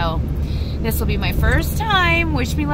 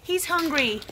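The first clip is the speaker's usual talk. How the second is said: ordinary delivery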